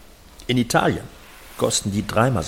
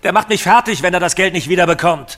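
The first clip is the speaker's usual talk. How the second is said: higher and louder, agitated rant; loud desperate rant; angry shouting; 6 semitones higher, 8 dB louder than usual. Acted. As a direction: yell out your panic and anger. Shouting about the Belarusian creditor.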